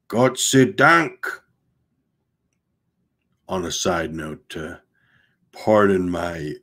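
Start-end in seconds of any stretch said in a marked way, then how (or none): 0.1-1.2 a slow, loud, higher German cry of thanks; loud and distinct. A narrator's reading. The captain calls out to the shore party, loud and distinct. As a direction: call out the thanks loud and clear. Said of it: "loud and distinct, speaking in his native tongue".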